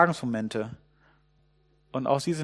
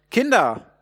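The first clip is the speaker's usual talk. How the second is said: high, raised call to a boat; calling across; 7 semitones higher, 5 dB louder than usual. Acted. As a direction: call out from the shore. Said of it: "der ruft ihnen hinüber".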